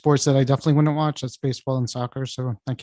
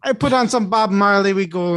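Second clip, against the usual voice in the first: mock Jamaican accent, starting high and loud; 7 semitones higher, 5 dB louder than usual. Co-host riffs on [name] and clubbing, mock Jamaican accent. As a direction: put on a jokey Jamaican accent. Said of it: "I thought we were in Jamaica".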